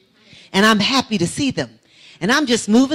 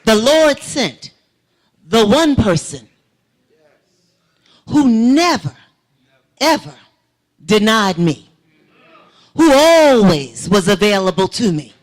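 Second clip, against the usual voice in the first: preaching; 6 dB louder than usual. Real, not acted. Woman testifying to church about her father, loud preaching. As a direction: preach it to the congregation.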